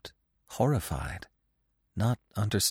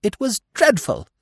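high-pitched, agitated squirrel; agitated cry; 13 semitones higher, 4 dB louder than usual. A narrator's reading. Squirrel telling the deer of the horror, agitated cry. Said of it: "he cried".

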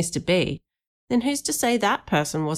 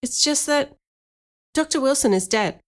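put-on higher-pitched boy blurting; mild, high blurt; 7 semitones higher than usual. A narrator's reading. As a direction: let it out in a raised young voice. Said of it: "Danny blurted".

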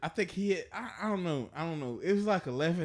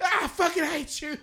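high, laughing mock fury; laughing mock anger; 6 dB louder than usual. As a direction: pretend to hate him, laughing. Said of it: "He goes".

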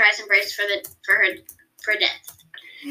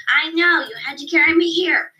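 through gritted teeth; 6 semitones higher, 4 dB louder than usual. Acted. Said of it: "She gritted her teeth".

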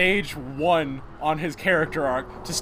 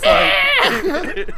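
whiny little voice; 5 dB louder than usual. Retold or imitated. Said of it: "a freaking whiny little voice".

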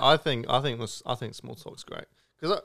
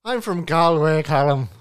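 put-on Irish accent; 4 semitones higher, 10 dB louder than usual. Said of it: "spoke in an Irish accent".

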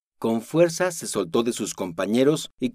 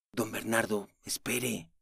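soft, much quieter plea; murmured; 9 dB quieter, slower than usual. Acted. Alfredo murmuring to Don Bernardo to stop him, quiet. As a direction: murmur his name as he leaves. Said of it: "musitó Alfredo".

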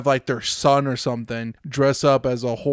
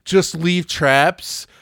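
normal talking voice

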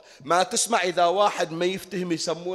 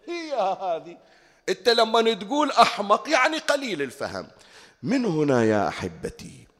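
normal talking voice

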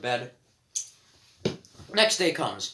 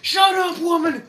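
high, loud shout; 14 semitones higher, 7 dB louder, slower than usual. Pretend. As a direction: yell it like an angry officer. Colonel yelling at mom.

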